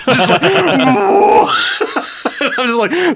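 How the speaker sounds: laughing hard, voice high; cracking up while talking. Real, not acted. Laughing at the theater memory.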